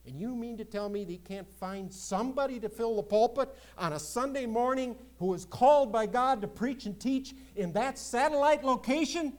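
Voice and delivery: incredulous preaching crescendo; rising indignation. Real, not acted. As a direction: climb in outrage. Preacher's indignant rhetorical question.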